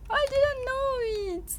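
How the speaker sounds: high, slow, drawn-out wail; dramatic lament. Real, not acted. Streamer lamenting a recipe mistake to viewers, wailing. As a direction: whine it out high and slowly.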